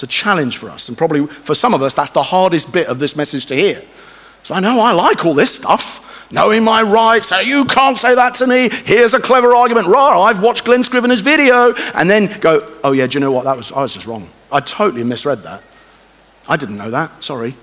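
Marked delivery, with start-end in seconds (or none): 4.4-11.7 smug arguing. Retold or imitated. Mocking his own clever arguing. 12.8-17.6 softer, lower honest climb-down; humble apology. Retold or imitated. Humble admission after arguing.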